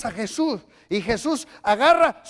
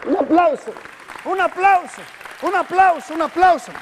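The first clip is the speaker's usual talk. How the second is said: calling for applause; 4 semitones higher, 3 dB louder than usual. Real, not acted. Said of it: "calling for applause".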